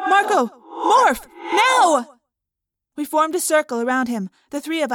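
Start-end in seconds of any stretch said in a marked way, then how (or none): 0.1-2.2 high, loud, punched shouts; urgent command. Acted. Friend drifting off and losing blood, shouted orders. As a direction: shout each word as an order.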